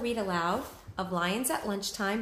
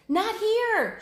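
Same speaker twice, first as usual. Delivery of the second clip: much higher, louder warning yell; shouted; 8 dB louder than usual. Acted. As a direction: shout to turn the herd away. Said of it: "she shouted".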